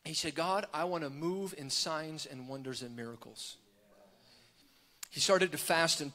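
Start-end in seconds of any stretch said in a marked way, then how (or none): 0.4-3.6 low, soft prayer; quiet prayer. Retold or imitated. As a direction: pray it softly, under the level of your preaching. Retold private prayer, said low.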